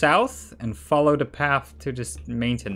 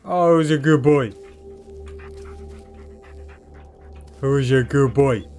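pet talk. Real, not acted. Praising the dog.

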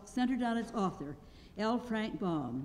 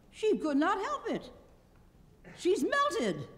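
Scarecrow voice; 11 semitones higher, 3 dB louder, slower than usual. A narrator's reading. Scarecrow explains to the guardian.

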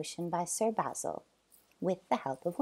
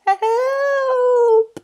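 high, loud drawn-out cry; screamed for help; 14 dB louder than usual. Acted. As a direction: scream it long and desperate. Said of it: "the bullies all screamed".

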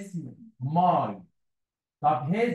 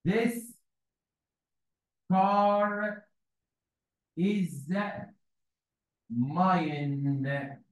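slow dictation; much slower than usual. Shown as a demonstration. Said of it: "ممكن أقولها كده بطريقتين".

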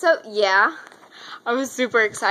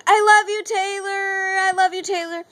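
mock scream, higher than usual talk; retold fan screaming; 7 semitones higher than usual. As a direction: call it out like an adoring fan. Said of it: "and I kept screaming".

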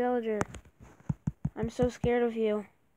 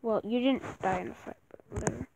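ordinary talk, nothing marked

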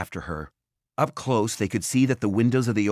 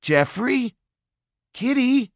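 much higher, louder anxious calls; calling pets; 4 dB louder, slower than usual. A narrator's reading. Simon calls for his missing pets by the broken tanks, high and anxious. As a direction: call for the lost pets. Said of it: "Simon called".

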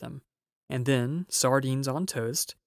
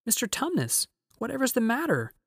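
put-on very high, worried child's voice; high-pitched, alarmed concern; concerned little girl; 10 semitones higher than usual. A narrator's reading. Lucy sees the faun in tears, high and concerned.

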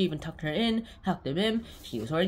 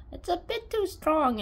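high mom voice; 12 semitones higher, slower than usual. Retold or imitated. Mom complaining about tea.

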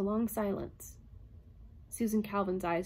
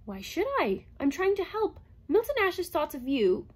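higher robot voice; 9 semitones higher, 5 dB louder than usual. A narrator's reading. Herbie coaxing Calvin, lightly higher robot voice. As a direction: coax her.

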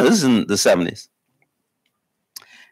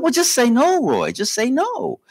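mock mother, voice raised high; mother's voice; 13 semitones higher, slower than usual. Wife telling husband to just say no, high voice. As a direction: mimic the wife's high exasperated advice. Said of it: "finally she said".

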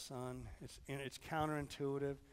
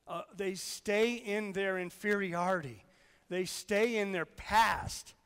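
loud, higher-pitched pulpit urgency; forceful preaching; 5 semitones higher, 7 dB louder than usual. Real, not acted. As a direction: drive it home, stretching the repeated phrases.

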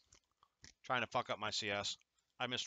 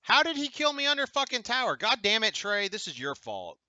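raging gamer; 11 semitones higher, 7 dB louder than usual. Real, not acted. Gamer dies under tower, rages.

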